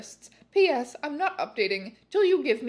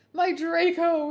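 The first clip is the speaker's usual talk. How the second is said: moaning; 6 semitones higher, 5 dB louder, slower than usual. Acted. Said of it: "he moaned".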